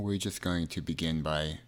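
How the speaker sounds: measured meditation delivery, phrases spaced far apart; guided meditation. Acted.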